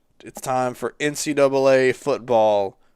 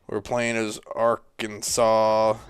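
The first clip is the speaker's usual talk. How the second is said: ordinary talk, nothing marked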